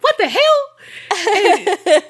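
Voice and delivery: high, very drawn-out mock outrage; incredulous outcry. Confronting Carrie at the wide open door, incredulous. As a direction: act out shocked disbelief, breaking into laughter. Acts out bursting in on friend.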